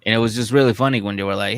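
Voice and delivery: ordinary talk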